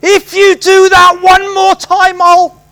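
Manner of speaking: high, loud, slow scolding like a cross teacher; finger-wagging scolding. Retold or imitated. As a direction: threaten like a parent wagging a finger. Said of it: "the parent kind of wagging their finger at you".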